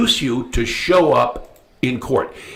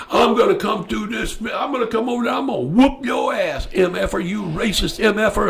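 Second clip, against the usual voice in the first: high-pitched mock threatening rant; retold threat; 8 semitones higher than usual. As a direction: imitate an aggressor working himself up, pitched high. Retold street threat, man trying gate.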